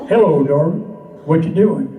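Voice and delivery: low, strong mock he-man come-on; most manly voice. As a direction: drop it way down into your manliest voice. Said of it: "I said in my most manly voice".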